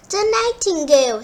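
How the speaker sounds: high quick shout. A narrator's reading. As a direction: shout it out eagerly. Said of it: "shouted the servants".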